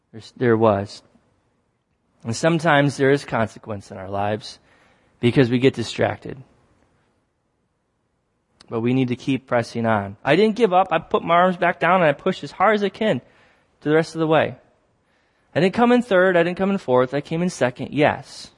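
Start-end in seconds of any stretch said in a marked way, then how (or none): none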